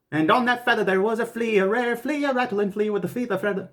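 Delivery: high, rushed one-breath attempt; all in one breath. Shown as a demonstration. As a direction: race through it without a breath. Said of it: "let's try to do it in one breath".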